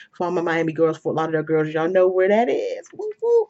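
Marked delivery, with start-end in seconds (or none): 2.9-3.5 high-pitched woo-woo; excited whoop. Real, not acted. Cheering to hometown girls about going home, excited high whoop. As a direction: let out a high, happy whoop. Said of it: "I'm so excited to go home".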